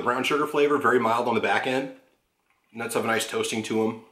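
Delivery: chewing while talking; eating between words; mouth full of cake. Real, not acted. Seen 5: Holds cake plate and fork; mouth appears chewing while describing flavor.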